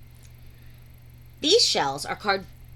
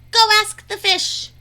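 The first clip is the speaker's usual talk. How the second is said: high, loud showman announcement; game show announcer; 10 semitones higher, 9 dB louder than usual. Acted. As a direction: announce it like a TV host. Announcing show segment title.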